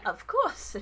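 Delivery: rabbit folk author voice. Acted. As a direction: play the shy, flattered rabbit writer.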